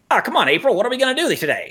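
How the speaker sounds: put-on tough-talking old-movie wise guy; Jimmy Cagney impression. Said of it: "that was kinda Jimmy Cagney".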